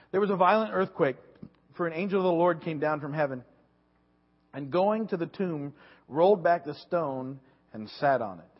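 loud, deliberate Bible reading; scripture reading. Acted. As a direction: proclaim the passage to the congregation. Reads Scripture aloud.

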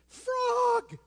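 high shrieked yell of the word Frog; retold scream. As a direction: shriek it like a wife startled awake. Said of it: "she screamed".

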